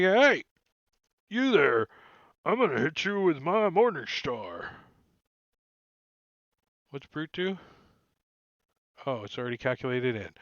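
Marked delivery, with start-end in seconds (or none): 0.0-4.8 raised, swooping bugbear voice; threatening bugbear. Acted. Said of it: "he's gonna be like".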